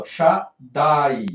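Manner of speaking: "Shaddai" very slow, split as Sha-ddai; drawn-out diphthong. Shown as a demonstration. Teacher shows patah plus yud, "Shaddai" drawn out. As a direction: stretch the ai glide ending "Shaddai". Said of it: "quando você tem um pata e yud".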